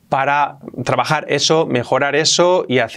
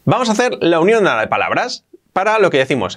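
ordinary talk

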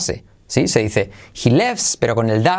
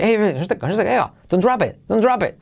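high-pitched, hurried warning; rapid demo warning, pitched up; quick urgent warning; 7 semitones higher, faster than usual. Shown as a demonstration. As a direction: blurt it out as if something is about to fall. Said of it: "hay que decirlo rápido".

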